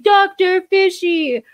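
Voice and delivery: high, slow, drawn-out cartoon wail; hammed-up villain grief, stretched out; Penguin voice. Mourning his dead fish, hammed-up high wail. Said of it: "And I'm like".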